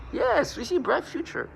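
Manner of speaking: sing-song mockery of their future; sarcastic praise. Sarcastic reply to accusers.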